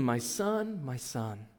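understated lament fading out; grieving lament. Retold or imitated. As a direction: let the grief sink away into nothing. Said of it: "he cried aloud".

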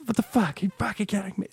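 lightly hammed Jersey swear; imitating the impression. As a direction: echo the impression of her road rage. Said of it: "me impersonating them".

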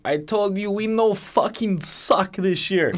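put-on slow, high drunk Russian accent; hammered Russian hockey star accent. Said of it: "You do a killer Russian accent".

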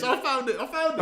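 high excited squeal; thrilled shout of discovery. Real, not acted. Finds the clip excitedly.

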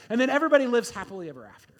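bright mock-cheerful ending; mock happy ending. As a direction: rattle it off like a cheesy storybook. Mock fairy-tale ending before sad truth.

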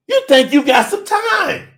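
normal talking voice

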